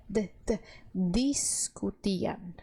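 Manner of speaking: "discutiam" slowly, the d kept soft, no dj sound. Shown as a demonstration. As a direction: ease the "d" in "discutiam", very slow. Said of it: "vai suavizar essa letra D". (d suave em "discutiam", bem devagar)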